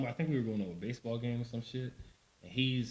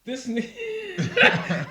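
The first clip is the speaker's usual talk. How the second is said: drawn out, much higher, laughing; laughing disbelief; 9 dB louder, much slower than usual. Retold or imitated. Son amazed at his father, drawn out and laughing. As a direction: marvel at the dad's nerve, laughing. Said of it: "I was like".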